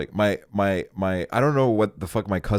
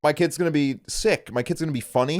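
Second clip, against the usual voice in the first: entitled parent; 9 semitones higher than usual. Retold or imitated. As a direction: brag like a proud entitled dad. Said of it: "entitled parents".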